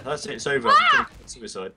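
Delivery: mock despair. Mock despair after game death.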